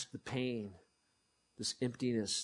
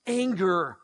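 normal talking voice

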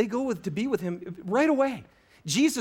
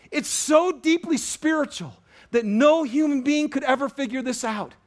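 full-force preaching; 6 semitones higher, 5 dB louder than usual. Acted. Pastor urging congregation, full-force preaching. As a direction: preach it hard to the room.

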